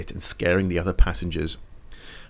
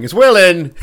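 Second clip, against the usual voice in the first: loud, high doorstep call; mock visitor; 8 semitones higher, 11 dB louder than usual. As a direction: call out for someone at the door. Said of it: "you're there with a ball peen hammer going".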